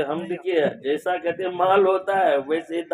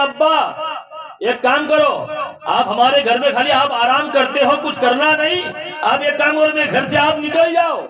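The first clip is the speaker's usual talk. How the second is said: put-on higher, harsh shouting at the old father; angry son; 11 semitones higher than usual. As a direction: throw him out with a shout. (بیٹا باپ کو گھر سے نکالتے ہوئے غصے سے چیختا ہے)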